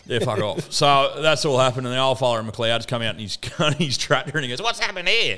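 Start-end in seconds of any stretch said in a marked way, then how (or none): 4.6-5.4 higher-pitched, imitated old fella's call; old farmer calling.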